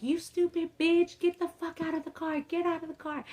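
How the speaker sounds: high-pitched imitation of an enraged cousin; cousin yelling. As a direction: spit it at her like a furious cousin. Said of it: "and was like".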